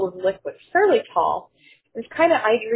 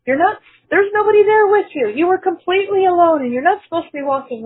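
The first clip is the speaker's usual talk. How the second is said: much higher and louder, mock-alarmed nurse; retold disbelief, pitched way up; astonished nurses; 9 semitones higher, 6 dB louder than usual. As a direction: play the flabbergasted nurses blurting it out. Nurses astonished patient walks alone.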